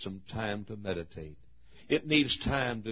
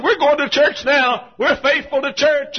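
high, loud, drawn-out proclamation; exultant retold announcement; 15 dB louder than usual. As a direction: shout the good news for her. Retells the girl's happy announcement.

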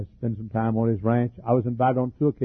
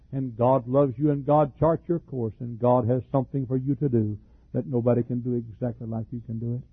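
ordinary talk, nothing marked

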